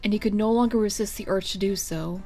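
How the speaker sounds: ordinary delivery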